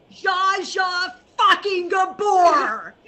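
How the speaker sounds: slow, loud shout with fucking punched hard; retold outraged shout. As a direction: bellow the name in disbelief. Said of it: "And she goes, pardon the language".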